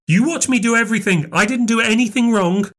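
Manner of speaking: high, hurried protest; defensive cry. A narrator's reading. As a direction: insist frantically that it's not your fault. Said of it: "cried Nan".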